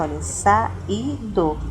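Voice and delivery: saído said slowly with the a and the stressed í split, sa-í-do; saído slow, a and í apart; slow hiatus demo. Shown as a demonstration. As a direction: stretch saído so the hiatus is heard, sa-í-do. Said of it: "a segunda vogal do hiato".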